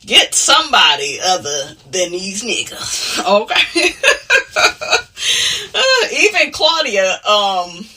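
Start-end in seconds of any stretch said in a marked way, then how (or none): 4.0-5.7 high breathy burst of laughter; cackling laugh fading down; real laugh. Real, not acted. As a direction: laugh freely at your own joke. Laughs after her joke.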